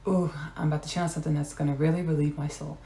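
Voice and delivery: soft, quick whisper; whispered aside. Real, not acted. Speaker confiding that channeling is coming, whispered aside. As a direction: drop to a hurried whisper.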